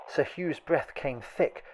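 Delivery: ordinary delivery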